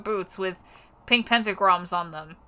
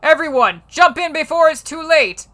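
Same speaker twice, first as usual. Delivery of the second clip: urgent call; 6 semitones higher, 6 dB louder than usual. Acted.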